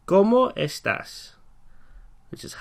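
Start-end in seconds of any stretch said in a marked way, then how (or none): 0.1-1.3 "¿Cómo estás?" at half speed, syllable by syllable; teacher repeating the phrase very slowly. Shown as a demonstration. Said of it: "So we can ask".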